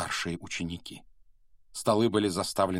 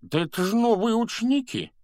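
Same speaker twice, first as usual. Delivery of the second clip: very high, loud jolly preacher ghost; loud, high cry of delighted discovery; delighted exclamation; 6 dB louder than usual. A narrator's reading. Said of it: "воскликнул толстый проповедник".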